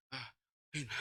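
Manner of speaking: faint gasped call; out of breath. Retold or imitated. Breathless husband calling his wife, faint gasp. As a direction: barely get the name out. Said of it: "Langsung segini".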